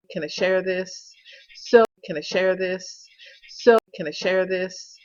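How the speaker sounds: calling out the question loudly; loud repeated question. Retold or imitated. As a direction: ask it loud, over and over. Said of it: "you can hear me asking the question".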